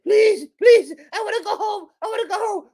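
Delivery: very high whimpering plea; imitating the Silence of the Lambs victim, very high; mock pleading victim. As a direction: beg like the trapped victim. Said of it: "and she's like".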